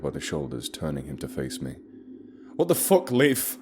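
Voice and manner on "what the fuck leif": furious shout, much higher and louder. Acted. Narrator shouting at guide Leif over the frozen friend, furious shout. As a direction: yell it at the guide in disbelief. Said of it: "I shouted".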